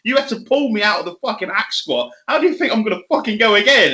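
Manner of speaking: normal talking voice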